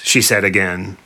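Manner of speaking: usual speaking voice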